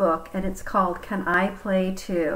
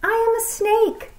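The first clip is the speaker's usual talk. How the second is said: high, quick cheerful snake; 13 semitones higher than usual. Acted. Snake telling Elephant and Piggy what it is, high and cheerful. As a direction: chirp it out brightly.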